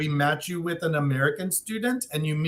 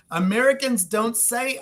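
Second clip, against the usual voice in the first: usual speaking voice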